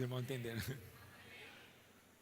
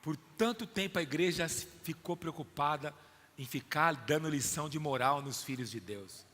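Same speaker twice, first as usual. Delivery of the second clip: ordinary delivery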